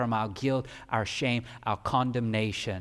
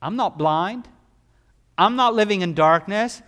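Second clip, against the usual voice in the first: defiant scoffing; 8 semitones higher, 12 dB louder than usual. Retold or imitated. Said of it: "Festus is looking at him and say".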